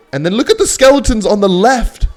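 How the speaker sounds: high, loud burst of excitement; excited shout. Real, not acted. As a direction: blurt it out high and loud at what's on screen. Sudden alarm at the game.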